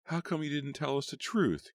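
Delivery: high indignant demand from Gail. A narrator's reading. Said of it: "Gail demanded".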